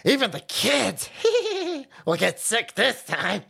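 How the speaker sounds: sly, slow mock gremlin with a laugh; a lightly voiced sneering gremlin, drawn out. Streamer mocking an official as a gremlin, sly and slow. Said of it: "that shit gremlin came out and goes".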